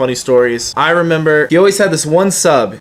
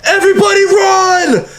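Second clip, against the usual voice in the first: panicked shout to run; retold scream. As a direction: shout a sudden alarm to flee. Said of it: "So I just scream".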